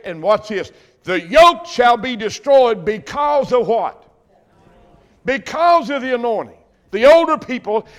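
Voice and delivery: loud, ringing pulpit preaching; preaching at full force. Acted. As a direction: preach it out to the back pews.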